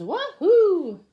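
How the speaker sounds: high, loud wahoo; joyful whoop. Acted. Duck thrilled driving the tractor, whooping.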